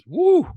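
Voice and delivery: high-pitched "woo" of disbelief; high whoop. Real, not acted. Comic recalling rough messages he got, high exclaimed woo. Reacting to wild hate mail.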